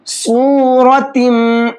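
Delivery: slow सूरतिम with thick sad and ra and a nasal tail; heavy sad and ra with ghunna. Shown as a demonstration. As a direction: chant सूरतिम with full heavy letters. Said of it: "सौत को मोटा करके पढ़ेंगे".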